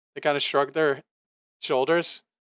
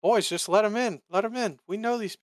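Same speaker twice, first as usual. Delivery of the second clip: high, eager vouching voice; charmed dwarf; 8 semitones higher than usual. Acted. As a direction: wave them off like old pals. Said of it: "the dwarf reassures the boys".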